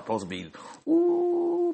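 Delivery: normal talking voice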